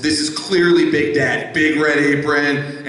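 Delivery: ordinary delivery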